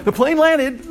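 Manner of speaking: high, loud flat announcement; mock poster. Teacher voicing a vague update, high mock voice. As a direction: say it like a clueless update.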